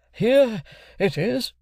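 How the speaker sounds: slow, high, drawn-out character voice; Wopsle. A narrator's reading. Wopsle finds the passage for the stranger, slow. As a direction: offer it up pompously.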